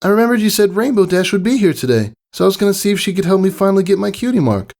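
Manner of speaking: high, fast and eager; high, fast filly explaining her plan. A narrator's reading. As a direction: rush it out like an excited kid. Scootaloo explains eagerly to friend.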